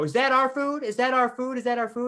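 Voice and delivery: very high, nagging imitation of a call; mock shouting. Customers leaning over counter to the kitchen, imitated shouting. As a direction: pester the kitchen with repeated high calls. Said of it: "shouting back to the kitchen".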